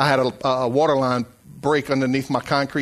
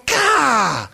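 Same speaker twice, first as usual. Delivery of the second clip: high, exasperated mock cry; raised-pitch venting of frustration; frustrated outburst; 9 semitones higher, 4 dB louder than usual. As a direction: let out an acted groan-like yell. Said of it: "I'm frustrated".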